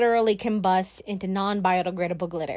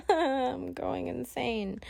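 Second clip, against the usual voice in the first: laughing; 3 semitones higher, 6 dB quieter, much slower than usual. Real, not acted. Laughs over being a junior.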